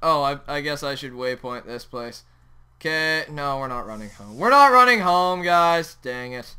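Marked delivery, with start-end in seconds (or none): 3.6-4.4 low, soft admission; subdued, low reversal; resigned decision. Real, not acted. Changes his mind. 4.4-6.0 raised-voice reversal; emphatic announcement. Real, not acted. Repeats reversal loudly to viewers.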